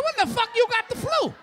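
high, loud disbelieving yell; incredulous shout. Retold or imitated. Husband to wife about her fake flu, shouting. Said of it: "I said".

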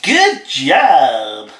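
loud, odd comedian catchphrase; comic put-on cheer. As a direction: put on a silly TV comic's praise. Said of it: "You sound like somebody from a T V program".

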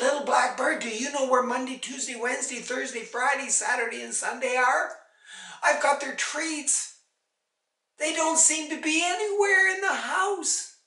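high, anxious appeal to a bird; worried plea. A narrator's reading. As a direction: ask urgently, pitch climbing at the end. Worried mother pleads with bird.

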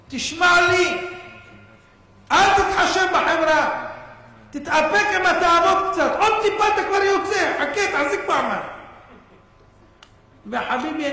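voicing God, pleading and rising loud; a fatherly plea that swells into near-shouts; preaching at full force. (דרשה בלהט, בקול רם ומתחנן)

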